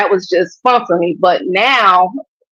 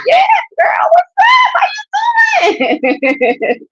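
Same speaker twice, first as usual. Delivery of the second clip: excited greeting; slower than usual. Pretend. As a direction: squeal it like you just spotted your best friend. Greeting fans out and about.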